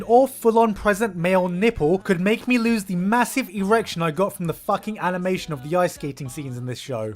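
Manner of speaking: ordinary delivery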